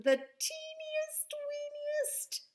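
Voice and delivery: put-on very high, slow teeniest weeniest; tiny squeaky voice. Betty playing down her mess, tiny and squeaky.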